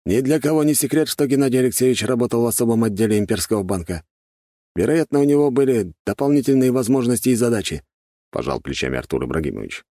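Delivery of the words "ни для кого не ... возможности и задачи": higher-pitched gendarme chief, lightly voiced. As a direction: lightly play an official reporting information, pitched up. Глава жандармов объясняет. (слегка сыгранный начальник жандармов, голос повыше)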